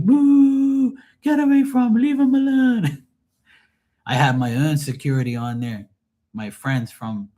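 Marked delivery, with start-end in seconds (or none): 0.0-3.0 very high, loud jeering falsetto; mock booing crowd. Passengers booing the drunk off the DJ, high mocking jeers. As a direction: imitate a whole crowd heckling, voice way up. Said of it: "And everybody's like".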